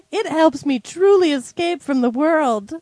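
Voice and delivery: high, slow put-on girly reading; girl's voice. Co-host reads a testimonial amid laughter, high girly voice. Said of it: "I can't even do a girl's voice 'cause I'm laughing".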